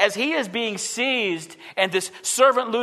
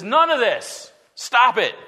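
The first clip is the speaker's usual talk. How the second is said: loud, raised-pitch rebuke; emphatic command; 4 semitones higher, 5 dB louder, slower than usual. Acted. Jesus halting the disciples after the sword strike, emphatic. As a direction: put an emphatic, forceful halt to it. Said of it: "looks and emphatically says".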